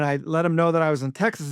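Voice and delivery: ordinary talk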